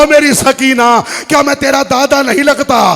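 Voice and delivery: loud, sobbing lament; weeping. Acted. Ali calls to Sakina, loud and weeping. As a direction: cry it out with grief. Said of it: "रोकर कहा".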